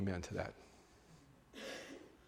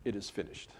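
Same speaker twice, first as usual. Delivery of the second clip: hushed last words; 3 semitones lower, 11 dB quieter than usual. Retold or imitated. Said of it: "Christ's statement".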